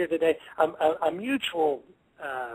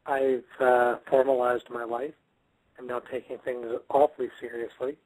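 flat, serious monotone; 7 semitones lower than usual. Pretend. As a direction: talk deadpan and formal. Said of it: "I'm not speaking in a very monotone voice".

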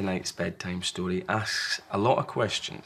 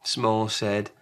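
plain reading voice

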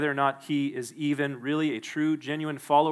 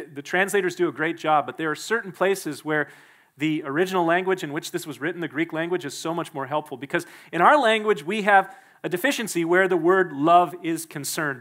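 usual speaking voice

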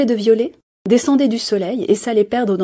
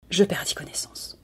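breathless whisper; 9 dB quieter than usual. A narrator's reading. The chair narrates fainting, in a whisper. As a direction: fade out in a whisper.

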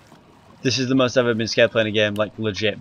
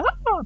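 mock scream; 5 dB quieter than usual. Shown as a demonstration. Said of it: "So I could, like".

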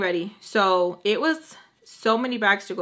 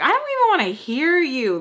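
raised, higher-pitched comeback; acted-out irritation, pitched up; retold angry retort; 6 semitones higher, 4 dB louder than usual. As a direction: snap it back in a mock argument. Retold fight with sister.

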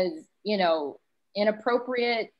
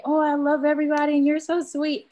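higher, gushing mock sweetness; fake sweet flattery; 6 semitones higher, 4 dB louder than usual. Two-faced person flattering someone to their face, gushing falsely. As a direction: put on an over-sweet, insincere compliment.

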